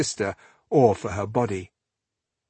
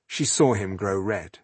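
ordinary delivery, nothing marked

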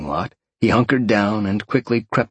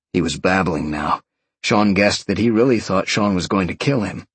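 usual speaking voice

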